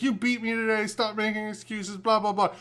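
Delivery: mock a sore loser; mock crying rival. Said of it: "and he's like".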